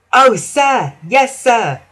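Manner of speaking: eager tiny engine peeping. Acted. Little green engine eagerly answering the Fat Controller, high peep. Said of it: "peeped the little green engine".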